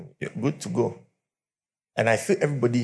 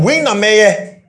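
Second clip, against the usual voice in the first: mock taunt; 9 semitones higher, 9 dB louder, slower than usual. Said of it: "As he said".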